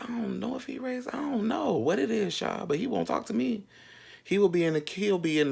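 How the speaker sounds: high, hushed, confused aside; telling friends, baffled, in a high voice. Retold or imitated. Quoting own confusion.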